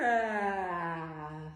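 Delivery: low drawn-out yawn; giraffe yawn. Shown as a demonstration. Teacher modelling a giraffe yawn, low and long. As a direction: sink into a deep lazy yawn. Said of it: "she yawns like this".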